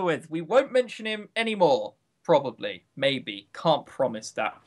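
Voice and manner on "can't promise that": ordinary talk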